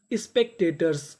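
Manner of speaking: ordinary delivery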